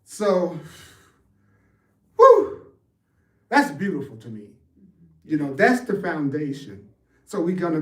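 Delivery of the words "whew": high delighted whew. Real, not acted. Overwhelmed by beauty of teaching.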